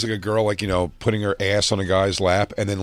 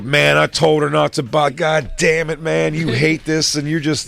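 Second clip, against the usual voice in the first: louder, higher, exasperated mock drunk; drunk fan imitation; 7 semitones higher, 5 dB louder than usual. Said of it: "'cause he's drunk also".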